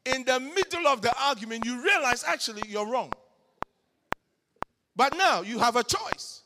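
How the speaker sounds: loud, driving preacher delivery; full force preaching. Acted. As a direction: preach it out to the hall.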